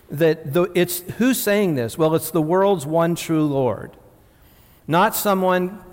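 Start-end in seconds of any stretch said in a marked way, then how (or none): 2.0-4.0 slow, weighty stress on world's; solemn proclamation. Acted. Preacher proclaiming to the congregation, slow and weighty. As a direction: lean hard into "world's one true Lord".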